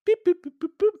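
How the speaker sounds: phone dial beeps. Pretend.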